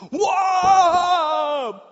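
loud, high yell while falling; falling whoa. Retold or imitated. Man jumps over the hole and falls, loud high yell. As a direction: yell as the jump fails.

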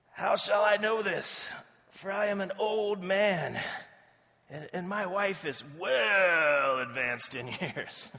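hammed-up doubtful complaint, drawn out; doubting old man. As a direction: drag out the key words in comic protest. Acting out Zacharias's doubtful reply.

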